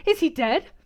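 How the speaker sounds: frightened question. A narrator's reading. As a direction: ask it in panic. Fearing her beloved is dead.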